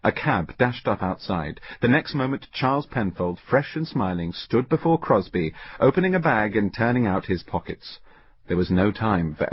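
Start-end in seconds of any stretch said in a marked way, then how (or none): none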